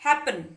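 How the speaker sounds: Happen loud on है, dropping on पन; stress on first syllable. Shown as a demonstration. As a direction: punch the opening है of happen. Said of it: "ए पे आ रहा है ना".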